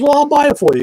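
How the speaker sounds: pitched well up, bright and eager, imitating girlfriend; girlfriend's eager offer. Retelling how his girlfriend offered to buy him the jack, in a mock eager high voice. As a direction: sell it like she can't wait to buy it.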